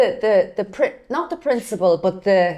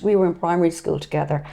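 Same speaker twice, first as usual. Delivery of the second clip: ordinary talk, nothing marked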